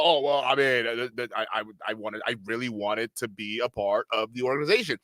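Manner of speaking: low, quiet, hesitant mock voice; Andrew Tate. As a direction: stammer it out low and softly, wistfully. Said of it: "where Tate was over here talking about".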